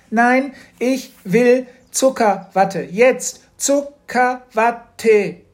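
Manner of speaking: put-on girl faking a screaming tantrum for candy floss; fake tantrum. A narrator's reading. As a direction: throw a convincing fake tantrum. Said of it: "Mathildas Anfall war täuschend echt".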